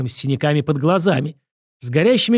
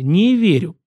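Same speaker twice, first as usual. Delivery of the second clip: louder, higher, gloating verdict; vindictive smile; 5 semitones higher, 5 dB louder, slower than usual. A narrator's reading. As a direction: pronounce it with a spiteful smile. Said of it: "добавил с мстительной улыбкой". (с мстительной улыбкой)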